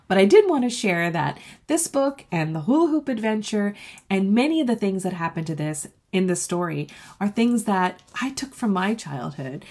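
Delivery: ordinary delivery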